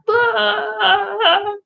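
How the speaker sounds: high, drawn-out wobbling "blah" cry; panicked mock wail. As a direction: let out a comic high-pitched wail of panic. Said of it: "it's gonna be like".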